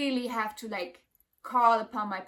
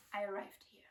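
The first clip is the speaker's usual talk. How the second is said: whisper; 11 dB quieter, faster than usual. Real, not acted. Quiet awe after relief.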